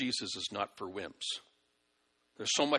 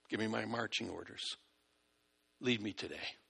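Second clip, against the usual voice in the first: much softer, humble and lowered; soft prayer; 4 semitones lower, 8 dB quieter than usual. Shown as a demonstration. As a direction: ask for guidance in a hushed voice. Modelling a prayer for guidance.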